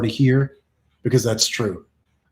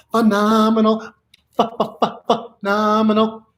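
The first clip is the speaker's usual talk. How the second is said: playful high voice; 7 semitones higher than usual. Pretend. Showing what he's talking about, playful high voice. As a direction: hold it up high and stutter playfully. Hammed-up repeat of phenomenal.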